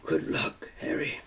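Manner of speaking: soft unvoiced whisper; murmured whisper. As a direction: breathe it as a whisper. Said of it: "he murmured".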